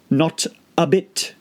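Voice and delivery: slow, dismissive creature voice; slow caterpillar voice. A narrator's reading. Caterpillar brushing Alice off, slow and bored. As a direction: drag it out, bored.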